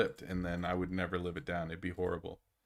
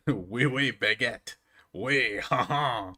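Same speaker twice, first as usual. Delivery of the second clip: hammed-up mock French accent, louder, laughing through it; 5 dB louder, much slower than usual. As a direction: laugh while butchering French, French accent on purpose. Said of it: "I know what it's supposed to sound like".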